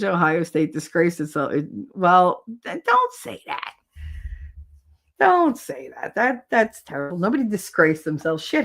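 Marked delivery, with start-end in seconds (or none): 2.6-3.7 very high-pitched pleading objection; squeaky protest. Real, not acted. Objecting to talk of team's disgrace, high squeaky protest. Protesting a remark, first take. 5.2-6.1 high start, falling firmly; emphatic protest. Real, not acted. Objecting to talk of team's disgrace, firmer falling protest. Repeats the protest more composed.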